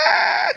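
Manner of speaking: high shrieking gasp of delight; shocked scream. Real, not acted. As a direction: let out a loud high shriek. Reacting to Rosa's shade.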